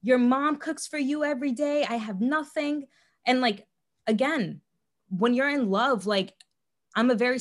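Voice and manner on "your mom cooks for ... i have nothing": higher, whiny guilt-trip voice; self-pitying victim. Retold or imitated. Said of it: "he played the victim role".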